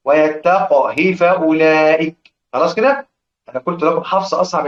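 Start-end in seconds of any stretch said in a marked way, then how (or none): 0.0-2.3 ويتقه with a silent qaf that bounces in qalqala, slow; correct qalqala. Shown as a demonstration. Teacher demonstrating the correct reading of ويتقه to students, slow, qaf with qalqala. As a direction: say ويتقه as "wa-yattaq-h", qaf stopped with an echoing qalqala. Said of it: "أكرر".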